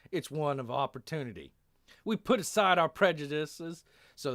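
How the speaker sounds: put-on impassioned speech in a Texan accent; loud, folksy Texan soldier making his case; speechify like a Texan officer. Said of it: "reading that in, like, a Texan accent".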